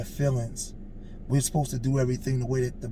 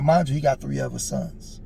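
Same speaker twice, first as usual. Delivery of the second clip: ordinary delivery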